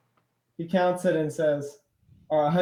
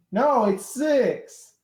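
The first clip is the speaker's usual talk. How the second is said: much higher, louder, drawn-out correction; insistent outburst; 8 semitones higher, 5 dB louder, much slower than usual. Acted. Cyclops overruling his partner's count, loudly insistent. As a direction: burst out with the silly answer.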